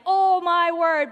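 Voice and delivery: drawn-out mock road rage. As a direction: vent at the driver. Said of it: "I want to be like".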